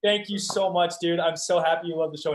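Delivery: high, loud retold gush; excited gushing thanks. Retells gushing thanks to fan.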